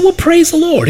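very high, sing-song "praise the Lord"; mock enthusiasm. Pastor answering the ex-dealer, high and mock-bright. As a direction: pop it up brightly, a bit ironic. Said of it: "I said".

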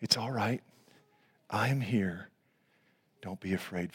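low, very soft reassurance; gentle carpenter voice. Acted. Jesus in the midst of the storm, soft comfort. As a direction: hush their fear, voice sinking softly. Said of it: "Beautiful carpenter voice".

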